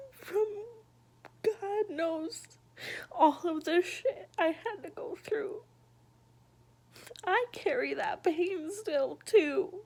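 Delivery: high and loud, near crying; pushing words out through tears; tearful, breaking. Real, not acted. Adult remembering childhood pain, voice breaking into tears. Talks of being a broken mess.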